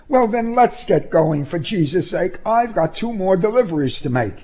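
high, impatient character voice; impatient activist. Acted. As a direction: hurry him along, pitch well up. Woman character's dialogue.

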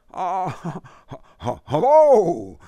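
stammering high, nervous hello; nervous stammered hello. Acted. Buckhammer stammers at the angry father at the door. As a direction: trip over the greeting in fright.

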